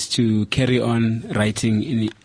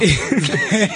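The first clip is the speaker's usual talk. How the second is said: burst of laughter; 10 semitones higher, 3 dB louder than usual. Real, not acted. Reacting to a joke.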